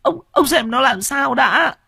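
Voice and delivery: very high, tearful begging with sobs; wife weeping as she holds him back; crying and pleading. A narrator's reading. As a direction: beg the husband to stop hitting the son. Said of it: "khóc lóc".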